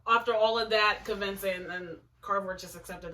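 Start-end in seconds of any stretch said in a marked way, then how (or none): none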